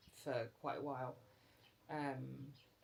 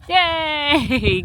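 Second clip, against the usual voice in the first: talking to a dog; 9 semitones higher, 25 dB louder, much slower than usual. Real, not acted. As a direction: gush over the dog, slow and high. Praising the dog after play.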